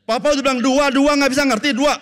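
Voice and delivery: very high, loud scolding; father losing his temper teaching sums; exasperated father yell. Retold or imitated. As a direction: shout it at the child in frustration. Father yelling at child.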